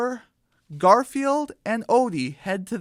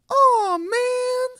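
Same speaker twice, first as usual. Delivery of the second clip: very high, put-on chick voice; squeaky cartoon "aw, man"; disappointed chick; 5 dB louder than usual. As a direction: groan it like a let-down kid chick. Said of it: "says Flower".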